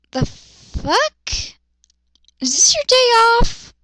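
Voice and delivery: exasperated comic rant; mock angry rant. Real, not acted. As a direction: scold the character like a boss. Reader rants at character.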